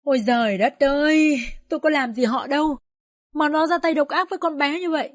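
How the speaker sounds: wailing lament. Acted. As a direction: cry out to the sky in grief. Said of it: "giơ chân dậm cẳng lên mà gào". (gào khóc than trời)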